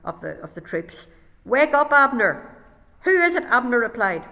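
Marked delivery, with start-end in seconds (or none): 1.5-2.5 loud call across the valley; shouting. Acted. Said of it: "Then he shouted down". 3.0-3.5 startled call. Acted. Abner woken by shout.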